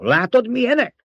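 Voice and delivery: very high, agitated exclamation; outburst. A narrator's reading. Baron exclaims over the enemy to the emperor, agitated. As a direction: burst out, voice shooting upward. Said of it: "Fakadt ki a báró".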